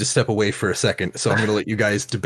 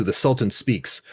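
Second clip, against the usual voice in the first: normal talking voice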